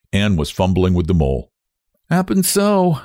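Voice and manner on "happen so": plain reading voice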